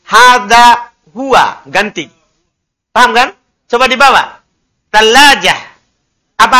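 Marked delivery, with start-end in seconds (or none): 0.1-1.6 hada huwa drawn out slowly; slow careful model. Shown as a demonstration. Teacher contrasting masculine hada huwa, slowly. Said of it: "Kalau laki-laki".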